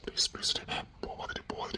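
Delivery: begging whisper; whispered pleading. Acted. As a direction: plead softly under your breath. Husband begs in whispers.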